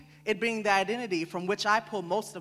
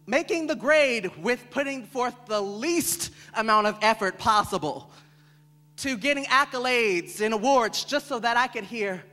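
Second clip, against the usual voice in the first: usual speaking voice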